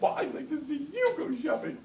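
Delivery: high, loud imitation of a wife's comeback; hammed-up indignant retort; mock angry wife. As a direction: throw it back as a shrill challenge. Wife's furious comeback in acted quarrel.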